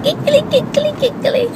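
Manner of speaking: very high, sing-song giggly mama voice; imitating friend's Italian mama. Imitating her best friend's mama saying they giggle, very high. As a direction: squeal it high like a laughing little mama. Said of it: "She would say we giggle like monkeys".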